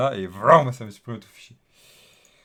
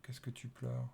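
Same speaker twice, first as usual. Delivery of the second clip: coaxing whisper; 3 semitones higher, 13 dB quieter than usual. Acted. Coaxing the crying app.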